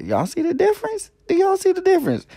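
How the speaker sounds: high squeaky diva voice; sassy show-off. Pretend. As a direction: show it off in a high-pitched brag. Showing off new eyelash.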